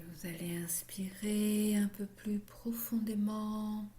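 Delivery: slow whispered breathing cues; whispered guidance. Acted. Yoga teacher guiding the class's breath, whispered. Guides breathing at session start.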